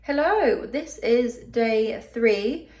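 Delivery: usual speaking voice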